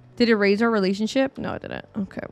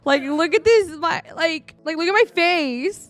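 voicing angry Lucian; 7 semitones higher than usual. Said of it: "He's like".

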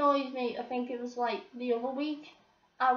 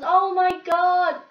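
slow, loud exasperated cry; loud exclamation; 5 semitones higher, 10 dB louder, slower than usual. Real, not acted. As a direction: let it out like you're fed up already. Exasperated outburst opening the rant.

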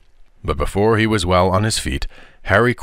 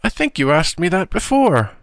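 high-pitched, put-on composure; strained nonchalance, pitched well up; feigned natural tones; 11 semitones higher than usual. A narrator's reading. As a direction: answer back defiantly in a high boy's voice. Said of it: "in almost his natural tones".

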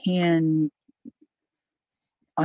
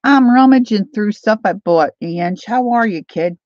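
booming update to a newcomer; loud announcing; 3 semitones higher, 10 dB louder than usual. Real, not acted. Vlogger telling an arriving viewer what's happening, loud announcing. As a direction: call it out much louder and higher.

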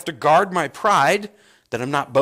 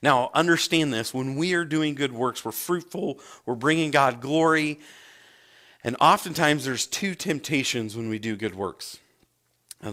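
ordinary delivery

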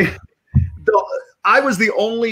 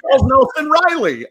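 comic impression; 9 semitones higher, 4 dB louder than usual. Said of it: "now I'm doing Paul Lynde".

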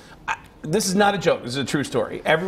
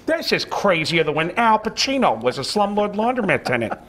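higher cartoon dad voice, delivering a gag; Peter Griffin; 4 semitones higher than usual. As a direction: put on a goofy cartoon character voice. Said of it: "doing one line as Peter Griffin".

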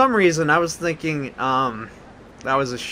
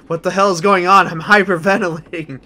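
re-enacted panicky outburst, somewhat louder; super excited; 4 semitones higher, 6 dB louder than usual. Player recounting his own freak-out, super excited. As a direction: mimic your own overexcited freak-out. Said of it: "I got super excited to the point".

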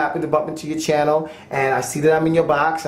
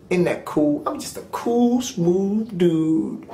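normal talking voice

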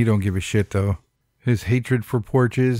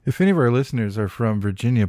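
ordinary delivery

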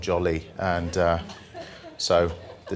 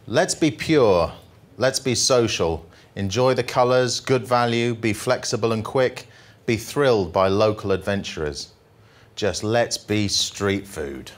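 jolly poem reading; 2 semitones higher than usual. Acted. Comic poet reading the happy poem to the audience, upbeat. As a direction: read it like a cheerful ode.

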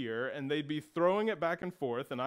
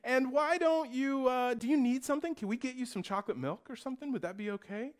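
much higher, sweet coaxing voice; sugary appeasing brothers; 9 semitones higher than usual. Retold or imitated. Older brothers bribing hurt brother with Sesame Street. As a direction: sweeten it way up to buy his silence. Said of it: "And they said".

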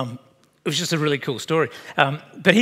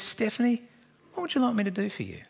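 higher, quick, light Jesus voice; lightly voiced Jesus asking a question; 6 semitones higher, 6 dB quieter, faster than usual.

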